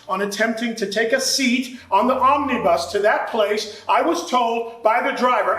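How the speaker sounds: normal talking voice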